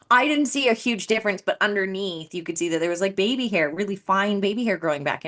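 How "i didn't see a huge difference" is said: normal talking voice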